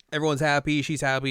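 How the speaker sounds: normal talking voice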